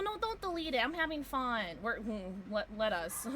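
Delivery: usual speaking voice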